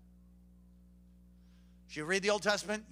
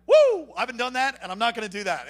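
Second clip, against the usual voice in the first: excited whoop; 3 semitones higher, 8 dB louder than usual. Acted. Believer whooping as he dodges a bad example. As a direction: whoop with relief.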